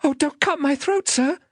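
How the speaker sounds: put-on high-pitched, frightened child's plea; terrified pleading. Child begging the man who seized him, terrified. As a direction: beg for your life in a high little voice. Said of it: "I pleaded in terror".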